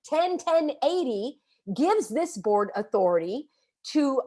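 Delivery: slow, deliberate, spelled out for the crowd to repeat; slow civic-lesson recital. Shown as a demonstration. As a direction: lay each word down so they can repeat it. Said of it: "repeat after me".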